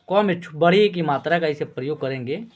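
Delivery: ordinary delivery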